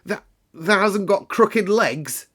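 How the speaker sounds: put-on old gardener quavering in disbelief. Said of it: "quavered Ben, more hoarsely yet".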